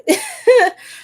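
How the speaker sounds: high squealing laugh; burst of laughter. Real, not acted. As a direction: laugh out loud, high and bright. Laughs at own joke.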